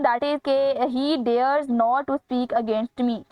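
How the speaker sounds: reading the sentence very slowly. Shown as a demonstration. Teacher reading a quiz sentence to students, very slow. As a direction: spell out every word of the item.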